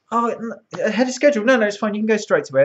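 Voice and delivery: hurried producer. Retold or imitated. Producer rushing him on air.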